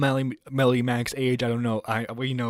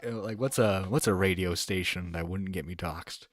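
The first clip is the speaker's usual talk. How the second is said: normal talking voice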